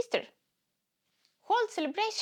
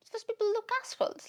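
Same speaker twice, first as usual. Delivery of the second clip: mock voice; 12 semitones higher, faster than usual. Mocking the other people.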